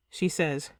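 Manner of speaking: ordinary delivery, nothing marked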